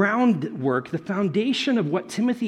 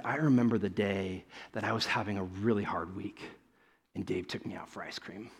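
soft retelling; 9 semitones lower, 10 dB quieter than usual. Retold or imitated. Student's quiet memory.

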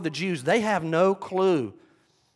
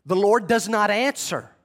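ordinary delivery

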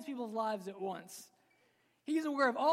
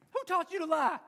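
put-on high, louder parent scolding a toddler; mock scolding parent; 7 semitones higher, 7 dB louder than usual. Parent scolding a toddler who lied, mock stern. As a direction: play a parent catching a toddler's lie.

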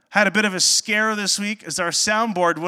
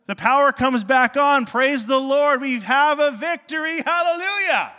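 rising higher and louder into hallelujah; joyful praise; 7 semitones higher, 4 dB louder than usual. Retold or imitated. Pastor celebrating the power coming back, rising praise. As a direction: celebrate like the lights just came back.

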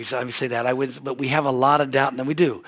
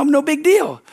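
mock nonchalant; 11 semitones higher, 7 dB louder, slower than usual. Mocking casual attitude.

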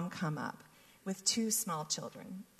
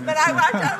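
laughing while saying it, louder; 8 semitones higher, 15 dB louder than usual. Real, not acted. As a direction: laugh it out with joy. Laughing at story end.

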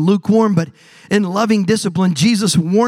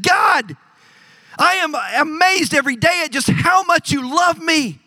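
raised and higher, full of wonder; awed cry to God; 7 semitones higher than usual. Retold or imitated. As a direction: call out to God in amazement. Pastor retells praying at sunrise.

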